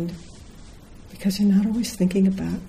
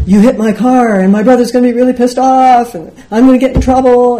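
loud, high, frantic complaining; worked-up outburst; 6 semitones higher, 10 dB louder than usual. Retold or imitated. Upset driver afraid of older brother's anger, loud outburst. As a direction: rant as if swept up in the row. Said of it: "drawn into the maelstrom of".

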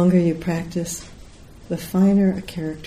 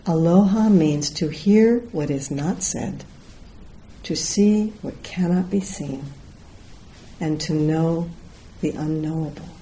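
solemn reading; 3 dB quieter than usual. Real, not acted. Teacher reading the Aloha Spirit law, solemn and measured. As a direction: let each phrase settle, softening toward the end. Reads closing words of the law.

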